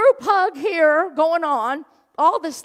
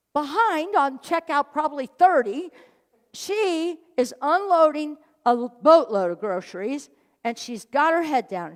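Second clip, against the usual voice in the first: ordinary talk, nothing marked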